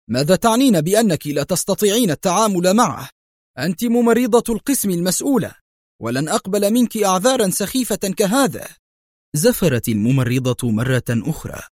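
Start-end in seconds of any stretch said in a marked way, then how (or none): 0.1-8.7 much higher, heated rebuke; angry outcry. A narrator's reading. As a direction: tear into the nurse indignantly. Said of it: "صاح الطبيب في غضب".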